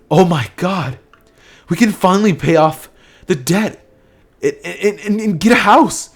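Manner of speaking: high, stammering mother's joy; overjoyed disbelief. Acted. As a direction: gasp out the dreams as they hit you, voice climbing. Mother overwhelmed by sudden money.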